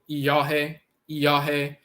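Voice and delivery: iyohe as ee-yah-heh; pronounced iyohe. Shown as a demonstration. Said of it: "That's another variation".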